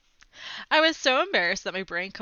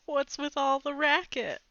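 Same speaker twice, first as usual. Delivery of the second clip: smooth joke; 5 semitones higher than usual. Acted. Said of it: "tried to be smooth and make a joke".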